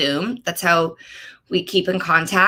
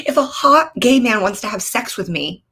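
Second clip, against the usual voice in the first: ordinary delivery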